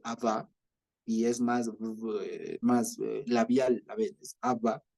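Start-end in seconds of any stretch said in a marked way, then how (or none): 0.0-0.5 "Abba" said ab-BÁ, last syllable stressed; stress on second A. Shown as a demonstration. Teacher shows how it is said, "Abba" stressed as ab-BÁ. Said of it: "Se dice Abba". 4.4-4.8 "Abba" said ab-BÁ, last syllable stressed; stress on second A. Shown as a demonstration. Teacher shows how it is said, "Abba" stressed as ab-BÁ. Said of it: "Se dice Abba".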